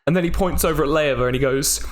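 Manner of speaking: ordinary talk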